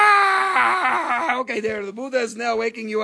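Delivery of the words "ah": jump-scare yell; playful scare shout at the viewers; mock scare shout. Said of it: "Now I scare you".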